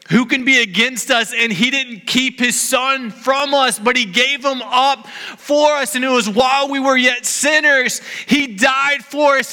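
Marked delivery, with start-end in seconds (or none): 5.9-9.5 preaching shout. Real, not acted. Preacher telling the congregation of Christ's death, shouting. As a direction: lean into it and proclaim.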